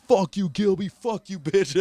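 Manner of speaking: high-pitched mock yell of abuse; high, heated replay of an outburst; retold angry cursing. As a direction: re-enact hurling insults, pitched well up. Retold cursing at rival backstage.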